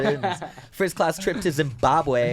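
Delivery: high, slow teasing lisp; mock lisp. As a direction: drag it out mocking a lisp. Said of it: "mocking the lisp".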